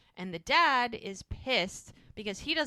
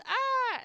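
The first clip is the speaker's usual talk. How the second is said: sudden high-pitched retold yelp of pain; imitated yelp; 5 dB louder than usual. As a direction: squeal it out like labor just kicked in. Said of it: "Charmaine yells".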